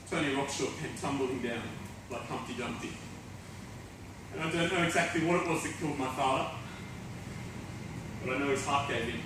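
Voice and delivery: low, soft, halting aftermath; subdued confession. Acted. Fallen rock star admits the damage, low and subdued. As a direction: confess it quietly, with long pauses.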